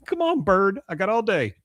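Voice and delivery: high-pitched imitated bird owner; put-on wheedling pet talk; coaxing a bird. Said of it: "and she goes".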